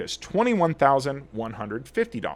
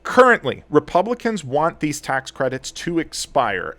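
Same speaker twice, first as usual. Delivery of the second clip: ordinary talk, nothing marked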